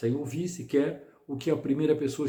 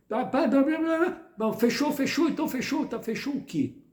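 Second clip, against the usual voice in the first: conversa apressada imitada; 11 semitones higher than usual. Retold or imitated. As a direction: chatter de deboche bem mais agudo. (imitação debochada de conversa de aplicativo, bem mais aguda)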